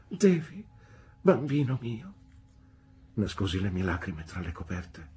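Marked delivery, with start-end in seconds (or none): 0.1-2.2 high, pleading motherly exclamation; exclaiming. A narrator's reading. Mother to her tearful little boy, exclaiming tenderly. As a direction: cry out to your child, high and louder. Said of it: "esclamò mamma".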